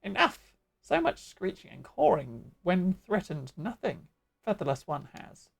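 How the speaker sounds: swooping bird-creature voice; Ixali bird voice. Acted. Ixali scolding the screeching flock, bird voice. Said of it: "keep doing the Ixali voice".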